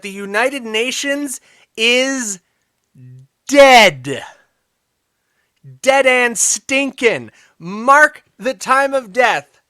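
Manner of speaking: slow and loud, like a eulogy; dramatic pronouncement. Acted. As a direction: declare it solemnly and forcefully. Dramatic declaration UN dead.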